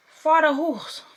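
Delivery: bad French accent. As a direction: put on a hammed-up French accent. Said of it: "That is not a French accent".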